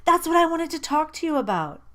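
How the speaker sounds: high, loud and gushing; bursting to share; excited. A narrator's reading. Excited reply to friend.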